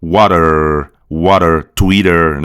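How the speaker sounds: "Water" and "Twitter" low, with held American R; rhotic American accent. Shown as a demonstration. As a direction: flap the T and curl the final R, American style. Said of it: "a roticidade".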